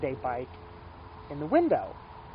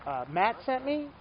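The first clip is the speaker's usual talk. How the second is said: unsure customer; 7 semitones higher than usual. Retold or imitated. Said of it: "somebody will walk in here from Texas and go".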